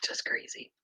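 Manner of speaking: breathy whisper to herself. Real, not acted. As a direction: barely whisper it, in awe. Hushed afterthought.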